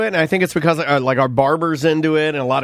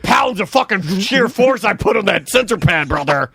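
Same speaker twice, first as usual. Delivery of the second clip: Hulk Hogan; 2 semitones higher than usual. Retold or imitated. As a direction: boom it out like a wrestling promo. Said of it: "I don't know why I'm talking like Hogan again".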